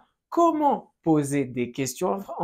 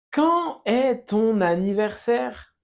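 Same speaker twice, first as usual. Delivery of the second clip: slow, higher teacher model; slow model phrase; 4 semitones higher, slower than usual. Shown as a demonstration. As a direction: draw it out for learners to repeat. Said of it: "Répète". (frase modelo lenta y aguda)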